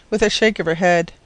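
plain reading voice

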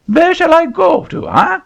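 put-on very high, indignant French accent; an outraged Frenchman. Poopoo refusing the auctioneer's seat, indignant French accent.

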